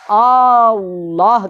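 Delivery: اللہ stretched very slow; slow teaching demo of اللہ; drawn-out Allah. Shown as a demonstration. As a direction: hold اللہ long and rising. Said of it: "تو لفظ اللہ کو".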